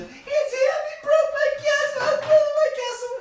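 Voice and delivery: high, loud tearful wail; a toddler sobbing out who wrecked her castle; crying accusation. Acted. Baby sister tells Mother that Bimbo broke her castle, wailing high.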